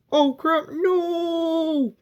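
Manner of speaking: high, hammed-up yelp of fright; mock panic. Reviewer playing Diana facing the ghost, mock panic. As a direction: squeal it like a cornered victim.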